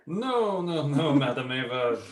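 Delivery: ordinary delivery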